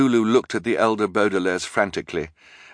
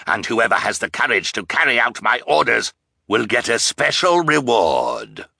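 raised, forceful villain's harangue; commanding roar; 5 semitones higher, 3 dB louder than usual. A narrator's reading. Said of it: "commanded in a terrible roar".

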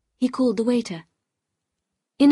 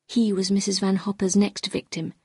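usual speaking voice

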